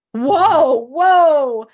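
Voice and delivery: loud, drawn-out whoa; startled whoa. Real, not acted. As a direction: pull back in surprise. Startled exclamation.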